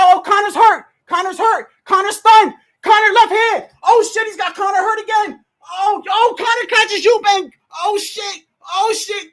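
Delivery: high, loud frantic calls of the action; excited shouting. Real, not acted. Conor hurt, frantic reaction.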